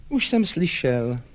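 slow, slightly strained reply; a touch of dejection, drawn out; uneasy, constrained voice. A narrator's reading. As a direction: answer slowly, with mild unease. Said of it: "Řekl to stísněným hlasem".

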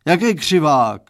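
dad roars at his daughter's word; roaring. Acted. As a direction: bark it out in outrage. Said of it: "zařval pan Stránský".

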